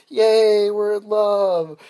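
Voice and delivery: high, loud, drawn-out cheer; hammed-up lovers' celebration; slow sing-out of pretend bliss; mock joy. Mock happy Hollywood romance.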